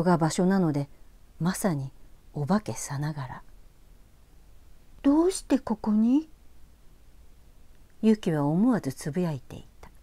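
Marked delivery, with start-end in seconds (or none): 5.0-6.3 put-on girl's murmur. ユキが思わずつぶやく.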